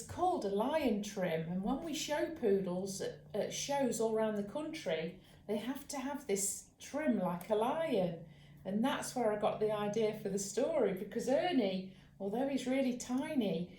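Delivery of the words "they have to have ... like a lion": ordinary delivery, nothing marked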